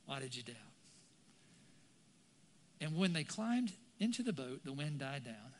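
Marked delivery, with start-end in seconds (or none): 0.1-0.6 soft, low, gentle question; quiet reproach. Acted. Jesus questions sinking Peter, quiet reproach. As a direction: ask it quietly after the storm of shouting.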